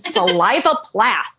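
Saliva Plath, said as sa-LIE-va; mispronounced name. Retold or imitated. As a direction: say Saliva Plath instead of Sylvia.

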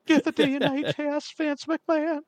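high, slow, creepy manager voice; Paul Bearer impression. Paul Bearer disowning Mankind, in a high slow whine. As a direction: keep the high drawn-out whine going. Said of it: "That's a brilliant impression".

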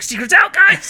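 high, loud warning yell; mock-panicked holler climbing in pitch; alarmed call-out. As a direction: shout it to the gang as you flee. Pets running off, warning others.

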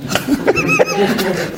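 a high, breaking burst of laughter. Real, not acted. Rabbi laughing at his own tears, high laugh. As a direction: let a helpless high laugh out.

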